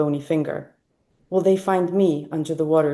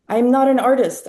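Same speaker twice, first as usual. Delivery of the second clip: louder, higher outburst, short of a scream; exclaimed protest; 7 semitones higher, 6 dB louder than usual. A narrator's reading. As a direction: protest it loudly to the world. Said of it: "I want to scream to the world".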